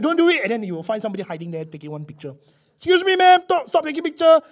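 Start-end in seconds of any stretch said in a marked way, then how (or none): 0.0-0.4 high, loud warning call; a museum guard loudly ordering tourists; guard's warning. Retold or imitated. 2.8-4.4 much higher, loud stern call; guard catching a tourist, loud and high; guard scolding. Retold or imitated.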